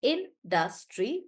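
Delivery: "Industry" slow, split as in-dus-try; syllable by syllable. Shown as a demonstration. Teacher showing how many sounds we hear, syllable by syllable. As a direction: separate each sound of "Industry". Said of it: "like that".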